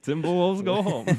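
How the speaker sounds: high, loud, laughing mock Borat accent. Friend taunting the Timberwolves, laughing Borat accent. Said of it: "He sounds more like Borat".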